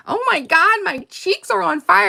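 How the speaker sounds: high quoted Barbie line; playful movie quote voice; quoting Barbie. Retold or imitated. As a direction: lightly mimic Barbie's squeal. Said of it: "One of our favorite is".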